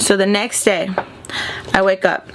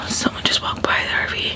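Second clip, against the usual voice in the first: hushed unvoiced whisper; 5 dB quieter than usual. Real, not acted. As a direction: drop to a secret whisper. Hushing as someone walks by.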